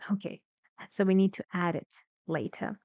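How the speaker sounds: normal talking voice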